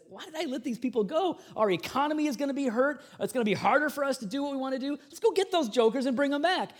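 voicing Pharaoh. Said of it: "and he says".

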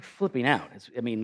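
ordinary talk, nothing marked

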